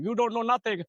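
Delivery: high, put-on dismissive voice; mock electrician voice. Electrician telling him he knows nothing, high mocking. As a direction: sneer it like the know-it-all electrician.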